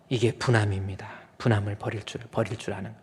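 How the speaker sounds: normal talking voice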